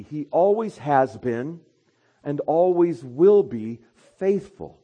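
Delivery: full-force sermon delivery; preach. Acted. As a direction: preach it out to the congregation.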